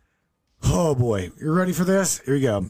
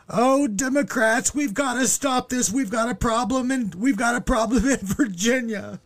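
high mocking imitation of an older lady near tears; mock tearful lady; 9 semitones higher than usual. Older lady in a video warning Democrats, high mock tearful voice. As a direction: ham up a fretful older woman on the verge of crying. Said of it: "now she's going".

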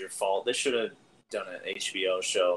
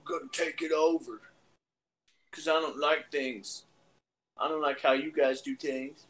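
higher-pitched parody of a TV hero; mock show character; 5 semitones higher than usual. Mocking the same thing over and over, in a put-on high character voice. Mocks show's repeated villain speech.

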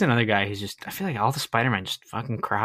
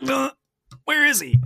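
much higher and louder, baffled; incredulous squeak; 5 dB louder than usual. Retold or imitated. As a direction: shoot up high in disbelief. Asking where Venom is.

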